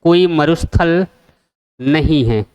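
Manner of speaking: slow, stress on कोई, then a pause before a falling नहीं है; slow emphasis. Shown as a demonstration. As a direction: drive home that there is no desert. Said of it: "ध्यान रखना है, important question है".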